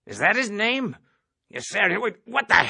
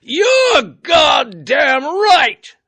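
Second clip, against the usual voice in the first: huge, slow, high roar; 14 semitones higher, 12 dB louder, much slower than usual. A narrator's reading. Colonel roars at the terrified cadet. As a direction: bellow it out word by word. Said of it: "roared the colonel".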